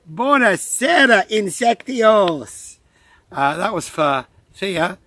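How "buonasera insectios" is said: exaggerated Italian accent, high, loud and slow; slow, drawn-out mock Italian accent; Italian impression. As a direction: ham up an Italian greeting in a broad Italian accent. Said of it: "best Italian impression or something".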